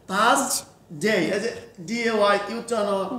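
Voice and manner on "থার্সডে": থার্সডে drawn out very slowly, syllable by syllable; teacher modelling থার্সডে slowly for a student; slow model word. Shown as a demonstration. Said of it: "তাহলে উচ্চারণ কি হচ্ছে?".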